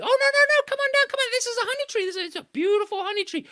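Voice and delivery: very high sweet wheedling; coaxing trickster. A narrator's reading. Anansi coaxing Monkey toward the honey tree, high and wheedling. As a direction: sell the honey hard.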